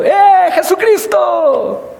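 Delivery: a stranger's high, slow taunting call; drawn-out mocking jeer. A passing man taunting the pastor in the church van, high mocking call. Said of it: "me volteó a ver y me dijo".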